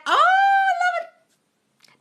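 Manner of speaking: very high, drawn-out slow squeal; delighted squeal. Real, not acted. Host squealing at a viewer's compliment, slow high delight. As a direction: stretch it out in pure delight.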